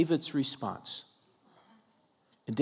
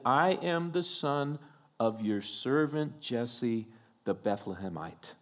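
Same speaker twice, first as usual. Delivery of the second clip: humble; 4 semitones lower, 5 dB quieter than usual. Retold or imitated. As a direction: answer simply, sinking low and quiet by the end.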